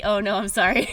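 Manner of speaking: normal talking voice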